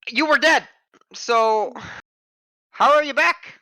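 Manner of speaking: put-on a nosy old shopkeeper; old lady voice. Shopkeeper lady questions Oik.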